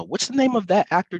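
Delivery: ordinary talk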